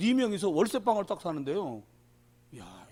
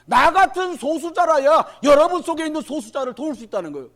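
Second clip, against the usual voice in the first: loud, higher preaching burst; forceful preaching; 8 semitones higher, 12 dB louder than usual. Acted. Preacher mocking a candidate's words to the congregation, shouted. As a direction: hammer the quote home to the congregation.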